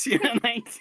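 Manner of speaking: ordinary talk, nothing marked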